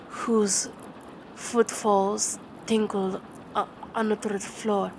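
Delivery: slow, grave reading with long pauses; dramatic poem recitation. Acted. Reciting Poe's poem to a baby, dramatic and grave. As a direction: read it like a haunted night.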